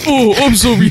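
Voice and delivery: high, loud sneering snob voice; mocking rich snob. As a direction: mimic him as a smug rich guy. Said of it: "and then you're like".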